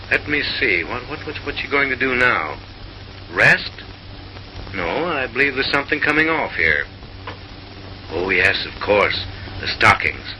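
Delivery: ordinary talk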